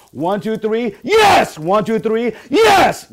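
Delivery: loud count exploding into a high-pitched YES; rousing shout. Shown as a demonstration. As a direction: show the crowd how hard to yell. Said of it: "I'm gonna show you what I expect".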